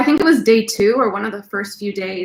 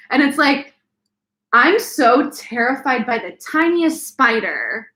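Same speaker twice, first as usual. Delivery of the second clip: ordinary talk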